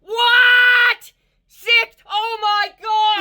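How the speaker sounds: high-pitched, loud shouts of disbelief; yelling in real shock at the meter; stunned shouting. Real, not acted. Car audio tester yelling at a shocking score, high and loud.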